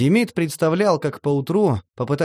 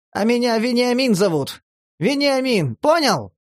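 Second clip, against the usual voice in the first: much higher, insistent, proud; strange new notes; 10 semitones higher than usual. A narrator's reading. Vena insists on his real name, much higher and proud. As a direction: claim your real name with wounded pride. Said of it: "в голосе Вены прорезались какие-то странные нотки".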